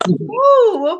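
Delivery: high, loud mock-startled "ooh"; retold startled reaction. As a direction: jump back vocally on a high ooh.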